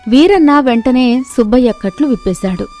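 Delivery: plain reading voice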